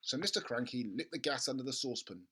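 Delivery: ordinary delivery, nothing marked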